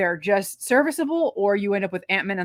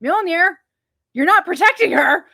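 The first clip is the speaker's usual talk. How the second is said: high, loud exasperated rebuke; mock scolding; 9 semitones higher, 7 dB louder than usual. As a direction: tell the hammer off, exasperated. Scolds the hammer directly.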